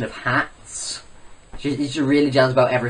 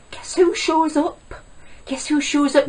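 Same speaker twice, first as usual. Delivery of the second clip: high-pitched dramatic reveal; mock suspense; 12 semitones higher than usual. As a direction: build comic suspense way up high. Building up to rival's entrance.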